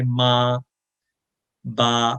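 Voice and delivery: ما and با each held one beat; ما, با stretched one alif; one alif length. Shown as a demonstration. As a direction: hold ما and با as long as opening a hand. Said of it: "ایک الف اتنا لمبا ہوتا ہے".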